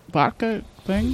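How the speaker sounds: talking through a mouthful of food; chewing while asking; mouth full. Real, not acted. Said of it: "you talk with your mouth full".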